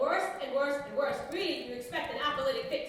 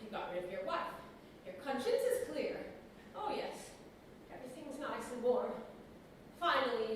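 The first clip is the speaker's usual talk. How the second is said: usual speaking voice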